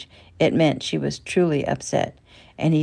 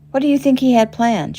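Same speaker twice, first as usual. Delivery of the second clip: higher, louder worried question; anxious question; 8 semitones higher, 6 dB louder than usual. A narrator's reading. Mother asking her husband about the threat, alarmed. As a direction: push the question at him, alarmed. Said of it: "she asked Eric".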